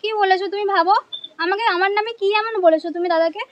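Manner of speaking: normal talking voice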